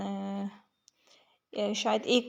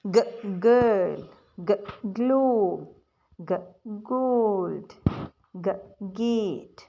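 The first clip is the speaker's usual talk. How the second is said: slow phonics drill; much slower than usual. Shown as a demonstration. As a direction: stretch each word like a teacher. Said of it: "अब जंबी जी वाली आ रही है".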